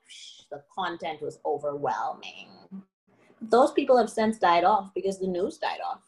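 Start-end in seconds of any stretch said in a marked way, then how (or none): 0.1-2.5 whisper. Real, not acted. Drops to whisper.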